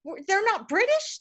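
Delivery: put-on high, incredulous child's questions; the child learning she isn't Italian, in high disbelief; childhood disbelief. Shocked little girl asking her mother, voice leaping high. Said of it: "and I go".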